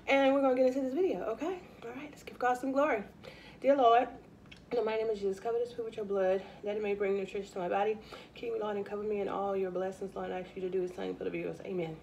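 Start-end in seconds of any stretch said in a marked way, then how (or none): 3.6-12.0 soft, hushed grace over the meal; quiet prayer. Real, not acted. Vlogger blessing her food before eating, in a quiet prayer. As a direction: murmur a heartfelt blessing to the Lord.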